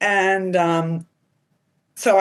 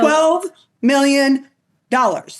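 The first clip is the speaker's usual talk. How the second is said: higher, louder, slow and drawn out; excited emphasis; 10 semitones higher, 4 dB louder, slower than usual. Real, not acted. Agent stresses the twelve million to host, drawn out and excited. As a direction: savour the big number.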